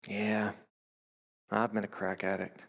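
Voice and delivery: low, soft retold admission; subdued confession. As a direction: imitate a man quietly owning up. Man finally confesses, retold.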